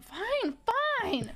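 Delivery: sassy. Retold or imitated. Drunk boyfriend giving in to her pleading, sassy. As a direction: imitate a sassy, grudging give-in. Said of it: "He's being so sassy".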